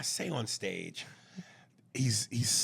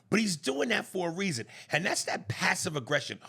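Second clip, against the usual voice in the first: ordinary delivery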